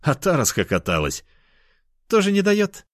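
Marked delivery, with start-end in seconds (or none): 2.1-2.9 put-on high girlish question; teasing cat girl. Girl mocking frustrated lover, high and playful. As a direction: tease him in a high voice.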